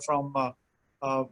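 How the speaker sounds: ordinary talk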